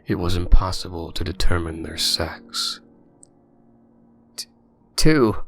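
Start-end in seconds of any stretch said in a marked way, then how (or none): 4.4-5.4 stammering, loud and higher; shaky voice. A narrator's reading. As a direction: stutter it out in fear. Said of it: "in a shaky voice".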